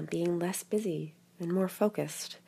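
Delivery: ordinary delivery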